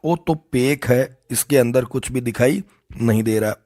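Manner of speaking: मारवाड़ी लहजे में; Marwari. Shown as a demonstration. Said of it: "Marwari में कैसे बोलते हैं?".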